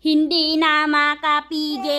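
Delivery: loud, drawn-out mock lament; playful dismay. Real, not acted. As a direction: stretch each word loudly in playful exasperation. Exclaiming over crying baby. (malakas at pahabang pagrereklamo)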